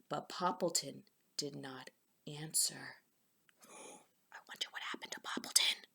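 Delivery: soft storytelling whisper; suspense whisper. Real, not acted. Suspense whisper reading and asides.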